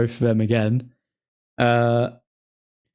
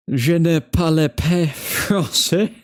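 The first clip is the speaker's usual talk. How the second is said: slow mock French; 3 semitones higher, 3 dB louder, slower than usual. Playing at speaking French.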